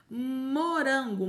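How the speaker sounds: "Morango" drawn out slowly; teacher stretching "Morango"; slow syllable demo. Shown as a demonstration. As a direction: sound out "morango" for children. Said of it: "mo, mo".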